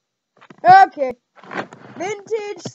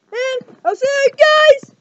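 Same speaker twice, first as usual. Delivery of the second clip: excited outro; 8 semitones higher, 8 dB louder than usual. Real, not acted. Big outro.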